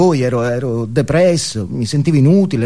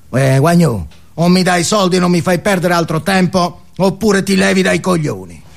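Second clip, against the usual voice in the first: lightly voiced vulgar shopkeeper, a bit louder; a hint of a coarse shopkeeper telling the kid off; rude shopkeeper; 6 semitones higher, 4 dB louder than usual. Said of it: "diventò volgare, oltre che scortese". (accenno di negoziante volgare e scortese)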